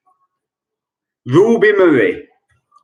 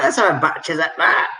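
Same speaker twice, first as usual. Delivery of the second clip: quick, lightly put-on Cockney; a cheeky London nudge; Cockney accent; 2 semitones higher, faster than usual. Shown as a demonstration. Quizmaster showing the phrase in use, light Cockney. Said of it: "I will try and do a Cockney accent".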